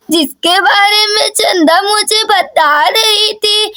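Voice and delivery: put-on slow, drawn-out old granny; granny greeting her grandchild, slowly; doting grandmother. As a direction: stretch the words like a grandmother. Grandmother speaks to Daisy.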